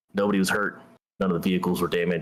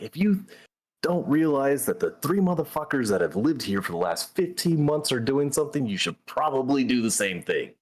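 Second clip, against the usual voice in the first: pitched well higher, mock yelling at the troops; first sergeant yelling at his company, imitated higher; retold yell; 7 semitones higher than usual. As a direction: bark it at the whole company, half-acted. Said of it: "He starts yelling at his".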